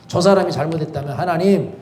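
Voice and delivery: ordinary talk, nothing marked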